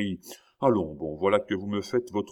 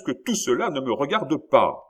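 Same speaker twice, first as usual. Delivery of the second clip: higher, louder exasperated cry; exclaiming; 6 semitones higher, 5 dB louder than usual. Acted. King cutting off his minister, loud exasperated cry. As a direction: burst out in irritation. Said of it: "s'écria Louis quinze".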